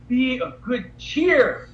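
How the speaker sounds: high and slow, leaning into cheer; Jesus's greeting stretched out, high and slow; slow, emphatic cheer. Shown as a demonstration. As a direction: savor the words slowly, a touch amused. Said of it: "Like, cheer, cheer".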